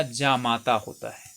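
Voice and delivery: जामाता with a long first a, said slowly; correct pronunciation, slow. Shown as a demonstration. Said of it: "ये जमाता नहीं होता".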